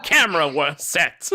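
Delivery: high, giggly cartoon detective voice; Inspector Gadget. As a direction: call the crew to order as a bumbling cartoon hero. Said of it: "that voice turned into Inspector Gadget".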